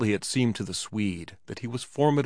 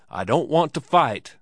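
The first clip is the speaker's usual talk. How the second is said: raised, higher protesting shout; 4 semitones higher, 5 dB louder than usual. A narrator's reading. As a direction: shout it out in alarm. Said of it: "he shouted".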